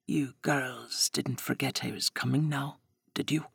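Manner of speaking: put-on a creepy visitor teasing the girls; menacing Spinner. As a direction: lean in with quiet threat.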